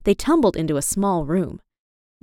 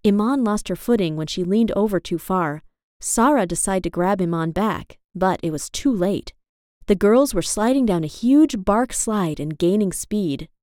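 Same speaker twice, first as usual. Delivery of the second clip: ordinary delivery, nothing marked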